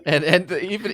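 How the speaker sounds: ordinary delivery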